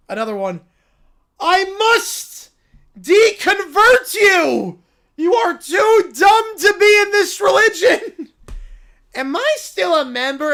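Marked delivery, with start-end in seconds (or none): 1.4-8.3 loud, half-laughing preacher rant; a zealot laughing as he rants; laughing zealot shout. Acted. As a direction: scold him like a furious cult leader. Character yelling, laughing.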